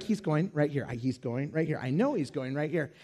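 low, soft thought to himself; inner muttering. Retold or imitated. Passenger watching the last guy approach, muttered thought. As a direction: say it under your breath, low and resigned. Dreading thought during story.

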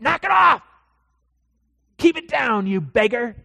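high, loud scolding; the crowd yelling at Bartimaeus; angry shout. Acted. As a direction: shut the beggar up. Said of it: "people around him say".

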